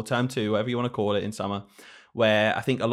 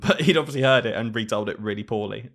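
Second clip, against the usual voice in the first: ordinary talk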